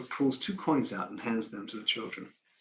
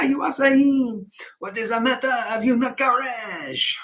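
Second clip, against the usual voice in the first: higher, pushy mock-foreign businessman; Von Hassler, overbearing foreign client; 10 semitones higher, 5 dB louder than usual. Foreign client presses Banks over his objection, overbearing and high. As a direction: badger him in a put-on foreign accent, pitched up.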